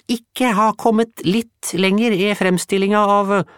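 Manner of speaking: very high, loud heroic boast; boastful tiny boy. Acted. Bulle boasting to the women of his brave expedition. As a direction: puff up like a tiny polar hero.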